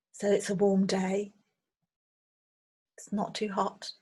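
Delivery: unhurried meditation guide, long pauses between phrases; guided meditation. Acted. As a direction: gently lead listeners through a visualization, leaving space after each phrase. Leads a guided visualization meditation.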